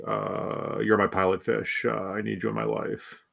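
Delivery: low, quiet, mumbled Belichick mumble; Belichick impression. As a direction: mumble it low like a gruff coach on the phone. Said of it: "that was my Belichick impersonation".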